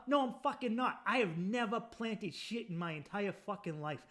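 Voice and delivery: usual speaking voice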